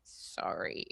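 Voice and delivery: voiceless, very soft whispered apology; sheepish apology. Retold or imitated. Stepmom apologizing to kid's mom, sheepish whisper. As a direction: shrink the word to an acted whisper.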